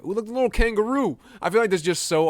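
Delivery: excited little coo; delighted squeal. Real, not acted. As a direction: gush over the little kangaroo. Delighted discovery.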